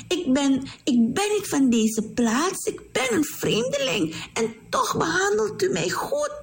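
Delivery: a hint of a humble girl asking why; Ruth's voice. Ruth speaks humbly to Boas.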